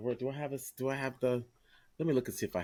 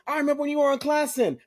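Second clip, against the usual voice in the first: excited recall; 6 dB louder, faster than usual. Retold or imitated. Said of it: "he's like".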